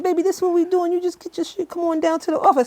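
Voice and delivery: high-pitched D impression; imitating D. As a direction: channel D, voice up high. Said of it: "Why you sound like him".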